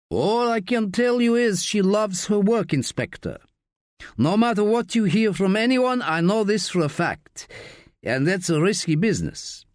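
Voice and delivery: lightly voiced Eastern European bar owner, cool and veiled; a hint of a smooth gangster's threat; Chankov's menacing voice. A narrator's reading. Bar owner veils a threat to the inspector, in a lightly accented cool voice.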